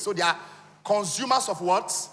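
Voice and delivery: ordinary delivery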